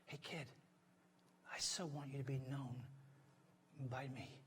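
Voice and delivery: hushed, halting fatherly appeal; tender Dad. Acted. Preacher voicing God as Dad to a child, tender and hushed. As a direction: speak softly, as a father to his child. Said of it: "Dad would say to all of us".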